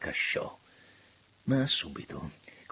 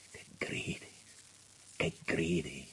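very soft, near-whispered words; hushed reproach; 6 semitones lower, 10 dB quieter than usual. A narrator's reading. Minister bent over after sobbing, hushed. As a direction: barely voice it, low and spent. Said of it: "gli disse".

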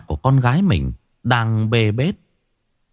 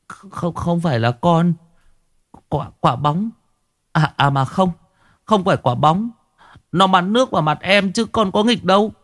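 put-on higher, a frightened boy stumbling over words; scared stammering; 7 semitones higher than usual. A narrator's reading. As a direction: stammer out excuses in fear. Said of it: "Nỗi sợ khiến cho lời nói trở nên lủng củng đứt quãng". (cậu bé sợ hãi, nói lắp bắp, giọng cao)

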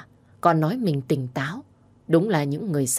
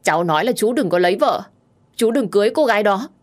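raised, higher, quick protest; loud declaration; 5 semitones higher, 4 dB louder, faster than usual. A narrator's reading. Tipsy girl tells uncle not to marry, loudly insistent. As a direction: say it so everyone must hear. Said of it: "Ngữ điệu của cô lớn đến nỗi".

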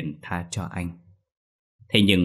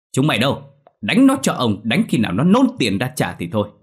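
higher, louder furious yelling, fast; angry landlord shout; 4 semitones higher, 5 dB louder than usual. Acted. Landlord roaring at a debtor who haggles, furious shout. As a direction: bellow at the poor debtor, then order the beating. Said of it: "Ông quát".

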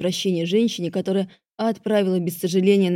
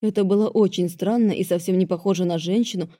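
plain reading voice

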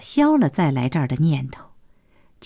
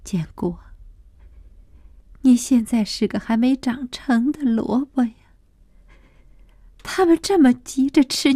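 tearful murmuring; 9 semitones higher than usual. A narrator's reading. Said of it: "流着眼泪，喃喃地说".

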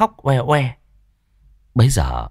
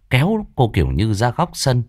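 ordinary delivery, nothing marked

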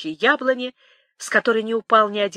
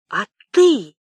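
loud, startled question; stunned and thrown off balance; dazed, stunned question; 2 semitones higher, 6 dB louder than usual. Acted. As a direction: blurt it out in stupefaction. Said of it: "ошалело спросил Темляков".

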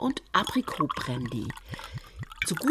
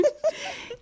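high short giggle falling away; a quick giggle after the pun. Acted. After her joke about the old days, short high giggle.

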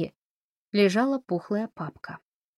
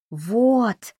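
delighted; 5 semitones higher, 5 dB louder than usual. A narrator's reading. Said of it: "обрадовалась Фанни".

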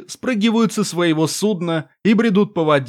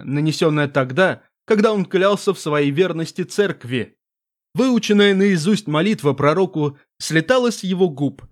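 ordinary delivery, nothing marked

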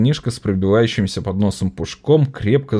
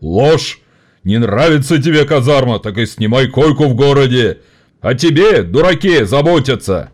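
loud, scornful anger; furious boss; 5 semitones higher, 5 dB louder than usual. A narrator's reading. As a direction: bark down at the worker. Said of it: "гневно". (гневно)

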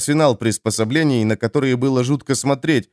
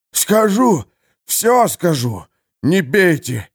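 сыгранный истошный крик пленника, выше голосом; мольба избитого тюремщика о пощаде; испуганная мольба; 7 semitones higher, slower than usual. Acted. (пленник в ужасе кричит Харману, чтобы не бил)

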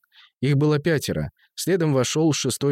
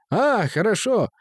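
high-pitched voice; 8 semitones higher, slower than usual. Acted. Deaf musician voiced high.

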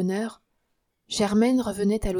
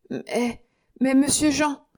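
higher, louder desperate plea; pleading protest; 5 semitones higher, 4 dB louder, slower than usual. Acted. Concierge to Jean, higher pleading protest. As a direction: beg to be heard.

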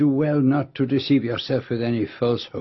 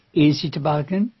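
quaintly humorous; 4 dB louder than usual. Acted. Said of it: "He was so quaintly humorous".

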